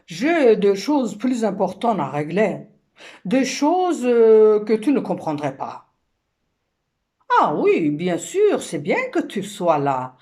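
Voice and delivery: réplique forte et hautaine de mari; rôle de l'homme. Acted. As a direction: rembarrer la femme à pleine voix. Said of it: "l'homme se retourne et dit". (homme agacé, joué avec emphase)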